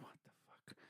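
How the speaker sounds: barely audible, voiceless aside; whispered disbelief. Retold or imitated. Hearing a cigar costs so much, whispered disbelief. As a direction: breathe it out under your breath. Muttered reaction to cigar price.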